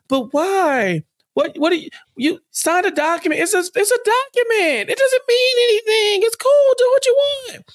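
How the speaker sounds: very high, rising into a crying whine; hammed-up high sobbing protest; mock whiny Oliver. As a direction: squeal it like a tantrum. Oliver mocked in shrill whine.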